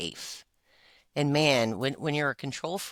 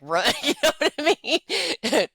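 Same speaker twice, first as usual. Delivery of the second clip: laughing; 11 semitones higher, 6 dB louder than usual. Real, not acted. Joking about running away.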